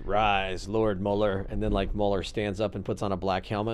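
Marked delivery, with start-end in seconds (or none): 0.0-1.4 low, slow command in a mock-villain register; Trump as Emperor. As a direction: play the Emperor commanding his apprentice. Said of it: "He just goes".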